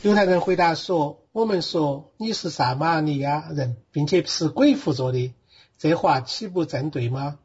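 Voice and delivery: steady verse-by-verse reading with Sichuanese pronunciation and tones; Sichuan dialect. Shown as a demonstration. Bible student reading John for the teacher on request, in Sichuan dialect. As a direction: read scripture aloud in Sichuan dialect. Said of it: "四川话念一念".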